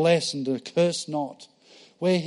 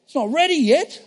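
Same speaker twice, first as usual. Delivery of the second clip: Jesus to his mum; 7 semitones higher, 5 dB louder than usual. Acted. Jesus answers his mother at wedding.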